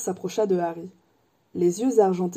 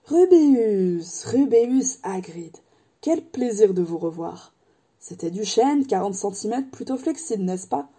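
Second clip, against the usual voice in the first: drawn-out, pleased greeting; delighted old wandmaker; slower than usual. Acted. Ollivander welcomes Hagrid back, slow and pleased. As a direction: savour the name.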